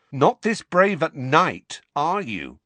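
slow, loud sneer. A narrator's reading. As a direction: sneer it down at him. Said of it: "sneered Dudley".

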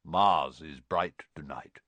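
low, slow centaur voice; measured, cryptic centaur pronouncement; simple statement. A narrator's reading. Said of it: "he said simply".

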